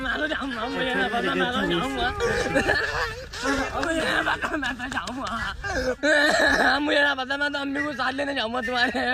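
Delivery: bawling and pleading to be taken along; whiny crying tantrum. Acted. Child to his father, crying in a tantrum. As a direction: stamp your feet and wail like a sulking child.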